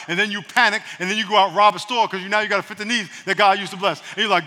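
ordinary delivery

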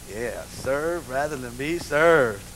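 low, very slow, soft husband; smooth husband. Retold or imitated. Father voiced in story.

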